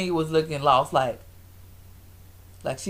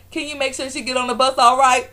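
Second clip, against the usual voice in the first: higher, louder exasperated request; mock calling out; 7 semitones higher, 5 dB louder than usual. Retelling Walter handing Kenya off, calling out. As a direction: imitate someone calling over to the crew.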